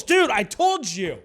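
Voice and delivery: loud, high-pitched exclamation; vindicated outburst. Real, not acted. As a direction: burst out as if proven right. Outburst on reading about nipples.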